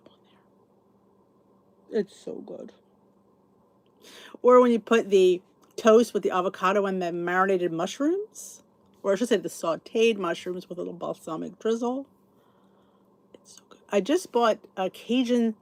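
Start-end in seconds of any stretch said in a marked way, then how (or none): 0.1-2.7 whisper. Real, not acted. Whispered food description. 13.3-13.8 a voiceless, much softer whisper; hushed delight at the taste. Real, not acted. Whispered food praise.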